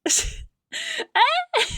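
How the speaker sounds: very high imitated sob; mock crying wail. Wife overcome by husband's care, mock wail. Retelling her own tearful reaction.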